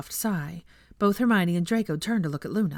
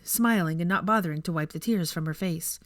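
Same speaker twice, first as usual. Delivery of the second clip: ordinary delivery, nothing marked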